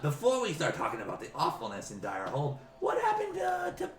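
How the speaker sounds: high, squeaky goblin with hesitations; halting goblin falsetto; evasive goblin. Acted. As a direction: stall and change the subject as a squeaky goblin. Goblin questions the ogre.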